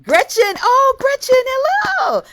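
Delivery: very high, loud, delighted hello; joyful greeting. Real, not acted. Host calling out to Gretchen on screen, thrilled and high.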